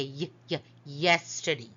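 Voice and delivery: "y, y" as short detached sounds, then "yesterday"; sounding out Y. Shown as a demonstration. Teacher showing Y begins a word, sounding out "y, y, yesterday". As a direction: sound the first consonant twice before "yesterday". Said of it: "You hear".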